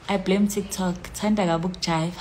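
usual speaking voice

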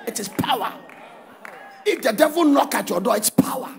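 loud, chanted blessing; preaching at full force. Acted. Preacher blessing the congregation, loud and chanting. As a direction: pronounce the blessing over the crowd.

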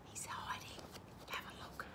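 whispered aside; hushed secret. Pretend. Reader telling children where the platypus hides, hushed whisper. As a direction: whisper it very softly, like giving away a hiding place.

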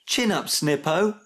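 high, cheering chirp; chirpy. A narrator's reading. Max cheering up his friend, chirpy. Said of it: "chirped Max".

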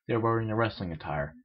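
ordinary delivery

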